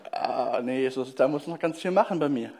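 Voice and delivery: ordinary talk, nothing marked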